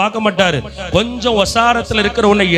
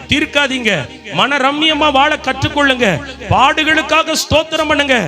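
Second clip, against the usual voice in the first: high, sustained revival-sermon cry; full-force preaching; 8 semitones higher than usual. Real, not acted. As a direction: proclaim each promise to the crowd in ringing phrases.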